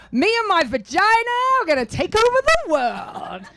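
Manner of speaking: high, loud, laughing triumph; triumphant. Retold or imitated. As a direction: declare it gleefully like a conqueror. Triumphant quote after session.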